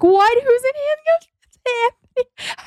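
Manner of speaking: high, strained panicky cry with a sob; half-laughing sob of alarm. Retold or imitated. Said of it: "I was like, crying".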